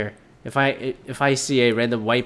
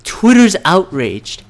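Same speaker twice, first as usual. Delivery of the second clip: slow, drawn-out mocking Twitter's outraged, louder and higher; mock outrage; 5 semitones higher, 5 dB louder, slower than usual. Ranting vlogger mocks the headline, slow sarcastic drawl. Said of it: "It's like".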